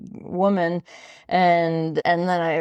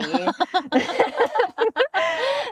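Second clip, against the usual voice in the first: bursting into a high laugh; real laughter at the punchline; 14 semitones higher than usual. Real, not acted. Laughing at husband's joke.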